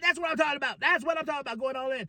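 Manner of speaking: a light hint of eager sidekick; Jay Lethal. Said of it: "Jay Lethal, like".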